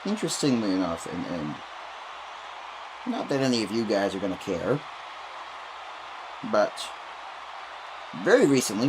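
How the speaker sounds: usual speaking voice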